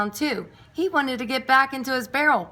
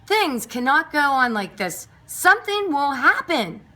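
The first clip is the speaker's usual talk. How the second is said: louder, higher warning voice; stern warning; 5 semitones higher, 5 dB louder than usual. A narrator's reading. Missus Goodking warning Pickles, stern raised voice. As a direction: warn him firmly. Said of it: "Missus Goodking said to Pickles".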